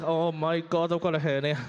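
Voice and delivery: low, strained groan voice; pained man. Retold or imitated. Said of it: "He's like".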